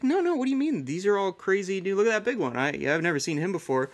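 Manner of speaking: excited high self quote. Retold or imitated. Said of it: "It's like".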